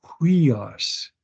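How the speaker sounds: "Huios" with a breathed H leading in; rough breathing. Shown as a demonstration. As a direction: say "Huios" as "hwee-os". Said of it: "Notice the rough breathing".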